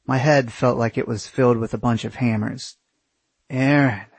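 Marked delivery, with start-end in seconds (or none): none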